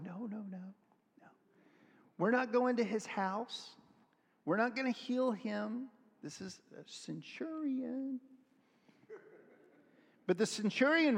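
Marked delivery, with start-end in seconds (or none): 0.0-8.2 softly voiced reluctant disciples, pitched higher; mock hushed protest, drawing out the last words. Disciples objecting to healing a centurion, reluctant and hushed. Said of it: "the disciples are going".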